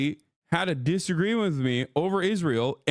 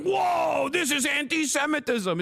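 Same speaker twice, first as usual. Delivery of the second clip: whiny mocking impression; 10 semitones higher than usual. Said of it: "And he's like".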